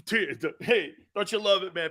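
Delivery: normal talking voice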